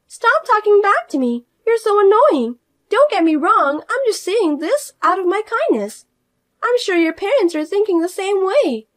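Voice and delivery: high, loud, self-important outrage; indignant sister-in-law. Acted. Fiona demanding her sister-in-law respect her, high and indignant. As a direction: demand respect she hasn't earned.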